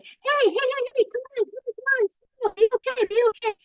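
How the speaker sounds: put-on a parent hushing a crying baby in a high sing-song; soothing parent. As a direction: coo at a crying baby to calm it. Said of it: "We're like".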